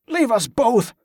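high, strained insistence; insistent demand. A narrator's reading. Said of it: "Wick demanded".

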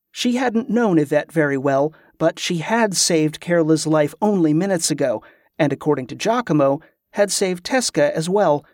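ordinary delivery

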